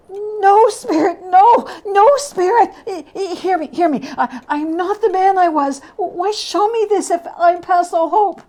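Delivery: put-on high, urgent begging old man; desperate pleading. Scrooge at his own grave, begging the phantom in high panic. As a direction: plead for your life with rising panic.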